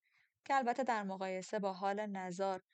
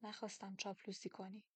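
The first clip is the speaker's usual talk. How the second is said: much softer, understated reproof; hushed, restrained reply; quietly; 11 dB quieter than usual. A narrator's reading. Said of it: "آروم گفت".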